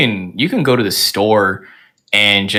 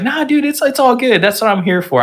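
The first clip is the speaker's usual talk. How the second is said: far higher, eager reassurance; reassuring. Retold or imitated. To the scared student, a high eager reassurance. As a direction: brush it off brightly, way up high.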